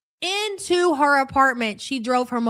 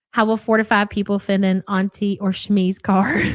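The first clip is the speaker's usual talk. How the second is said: normal talking voice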